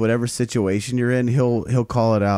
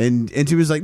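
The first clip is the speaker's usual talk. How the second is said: usual speaking voice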